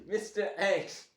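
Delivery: laughing, loud, drawn-out mock announcement; corny villain name. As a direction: say the name slowly, high and loud through a laugh. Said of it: "just sounds corny".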